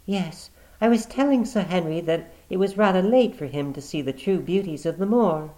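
high, light woman's reply. Acted. Sister covering with her brother, smoothly. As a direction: answer smoothly.

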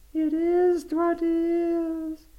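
very high, slow mournful ghost voice; moaning ghost. Acted. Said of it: "moaned the voice".

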